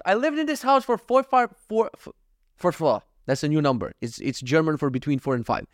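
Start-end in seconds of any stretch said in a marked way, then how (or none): none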